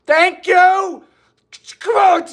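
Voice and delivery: excited. Real, not acted. Hundred bucks donation.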